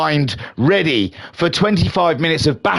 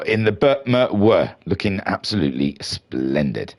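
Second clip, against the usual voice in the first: ordinary delivery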